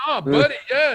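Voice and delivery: high, loud, enthusiastic burst; mock-excited director voice. Retold director's excited reply.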